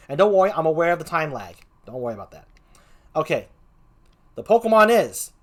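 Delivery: normal talking voice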